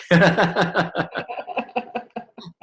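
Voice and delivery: a long, amused laugh at his own joke; hearty laugh after a quip; real laugh. Real, not acted. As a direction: laugh it off, high and light.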